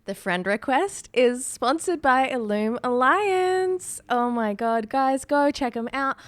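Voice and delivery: upbeat ad read, slow start; sponsor read. Acted. Co-host reads the sponsor spot to listeners, upbeat. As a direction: sell it like a host ad.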